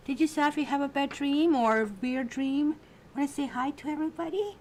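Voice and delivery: high, bright coaxing voice for the pet; pet talk. Real, not acted. Talking up to her pet Safi for the camera, high pet talk.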